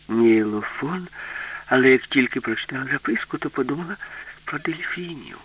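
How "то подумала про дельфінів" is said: usual speaking voice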